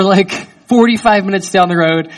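ordinary talk, nothing marked

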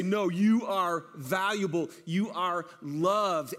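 put-on slow, tender reassurance to a child; reassuring. Volunteers telling a foster child they're loved, slow and tender. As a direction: lean in and tell them slowly they matter. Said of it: "come in and say".

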